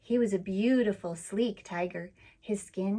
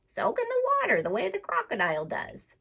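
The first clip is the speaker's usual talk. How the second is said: high, teasing parrot voice; a saucy bird giggling advice; laughing parrot; 11 semitones higher than usual. Acted. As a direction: parrot teasing Suki to soak like the crocodile. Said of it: "laughed the parrot".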